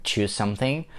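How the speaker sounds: ordinary talk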